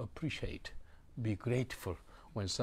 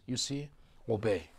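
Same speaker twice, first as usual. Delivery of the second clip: ordinary talk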